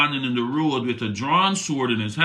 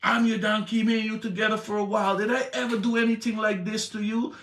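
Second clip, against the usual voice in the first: higher, pleading buddy voice; a hurt friend asking why; talking donkey; 7 semitones higher than usual. Acted. The donkey reproaching Balaam, higher and pleading. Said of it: "To the donkey saying".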